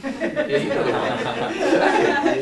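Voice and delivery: drawn out slowly through real laughter; laughing. Real, not acted. Speaker sharing a joke about draining patients with the audience, laughing. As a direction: laugh the words out, knowing.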